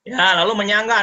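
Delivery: normal talking voice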